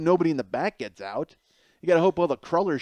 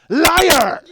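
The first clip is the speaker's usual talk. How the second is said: high, explosive accusing shout; very loud yell; 15 dB louder than usual. Retold or imitated. Brother calls out little brother over soup, full shout. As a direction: blast it right in his face. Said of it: "That loud".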